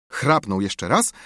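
usual speaking voice

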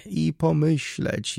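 high, slow, plaintive wail; moaning. A narrator's reading. Baroness lamenting her rival's luck, moaning. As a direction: moan it out slowly. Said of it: "jęczała".

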